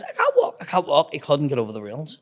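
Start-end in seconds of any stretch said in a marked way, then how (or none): 0.0-1.1 very high, hammed-up helpless cry; mock pained whine. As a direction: whine it like an injured mate. Acts out injured Pee Wee unable to walk.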